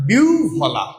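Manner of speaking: বিউ ফলা said as "biu-bhola"; correct pronunciation. Shown as a demonstration. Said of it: "উচ্চারণটা কি হবে".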